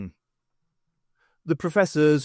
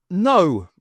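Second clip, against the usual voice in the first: loud, higher cry; 5 semitones higher, 8 dB louder than usual. A narrator's reading. Stokes stopping the fleeing academics, loud cry. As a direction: shout to stop them. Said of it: "cried Stokes".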